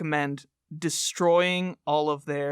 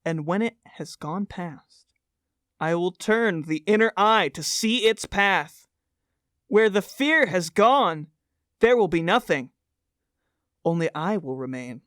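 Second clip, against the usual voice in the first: dramatic poster recitation. Acted. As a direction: intone each phrase like a cult creed. Reads poster litany aloud.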